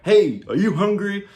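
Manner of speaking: high, loud cartoon mascot call; cartoon shark. Shark hailing a boy on a desert island, loud cartoon voice. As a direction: pitch it up and call out like an advert mascot. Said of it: "the shark comes up to him".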